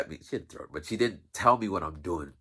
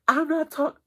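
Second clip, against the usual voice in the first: much higher, louder mock refusal; a high put-on lady shutting the kids down; imitating the office lady; 6 dB louder than usual.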